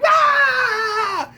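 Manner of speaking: high long mock wail of panic; mock freak-out cry. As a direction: squeal like a frantic fan. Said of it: "Everybody freaking out like".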